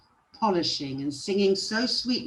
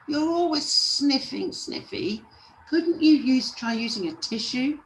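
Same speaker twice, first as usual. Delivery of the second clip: high, irritated storybook dwarf; cross, high-pitched reproach; exasperated complaint; 10 semitones higher, 3 dB louder than usual. A narrator's reading. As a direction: nag it in a raised pitch, fed up. Angry tells Sniffy what annoys him.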